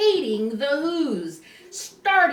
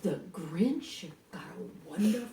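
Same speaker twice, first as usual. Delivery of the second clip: hushed sinister; 8 semitones lower, 9 dB quieter than usual. A narrator's reading. Narrator building suspense about the plan, hushed. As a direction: savor the wicked words quietly.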